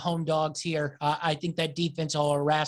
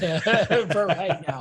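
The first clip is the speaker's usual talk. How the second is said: laughing; 4 dB louder, slower than usual. Real, not acted. Laughs at own DJ joke.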